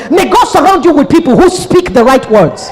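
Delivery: usual speaking voice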